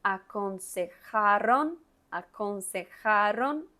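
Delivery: aconsejaron said slowly, jota and ere distinct; slow teacher repeat of aconsejaron; slow model. Shown as a demonstration. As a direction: drag out aconsejaron syllable by syllable. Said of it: "Mire que tiene la J, tiene la R".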